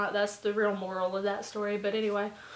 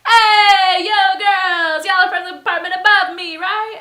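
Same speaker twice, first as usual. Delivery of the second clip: boisterous party shout; 9 semitones higher, 15 dB louder than usual. Retold or imitated. Party host hailing the girls from upstairs, boisterous. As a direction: mimic a loud, high drunk host.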